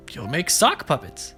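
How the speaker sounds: ordinary delivery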